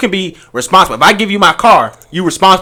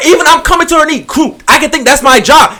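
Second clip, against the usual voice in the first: heated, raised voice in an argument; yelling; 8 semitones higher than usual. Real, not acted. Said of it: "All right, stop yelling".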